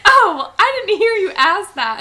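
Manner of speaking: put-on high, loud child's reply through laughter; squeaky-high retold excuse, giggling; laughing imitation. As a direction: mimic the kid while cracking up. Quoting the child while laughing.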